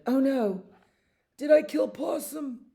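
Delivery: high, anxious little animal voice; worried beaver. Acted. Beaver fears it killed Possum, high worried voice. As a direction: squeak it out in alarm. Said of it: "No, the beaver said that".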